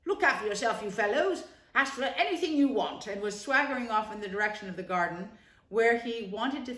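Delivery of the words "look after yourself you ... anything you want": breezy, offhand swagger; casual. Acted. Toad airily dismisses his friends after lunch, offhand. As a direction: toss it off like a lord. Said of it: "remarked casually".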